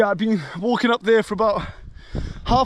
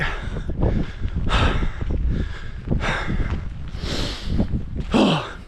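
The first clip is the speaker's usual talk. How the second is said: long exhale. Real, not acted. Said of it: "I'm out of breath".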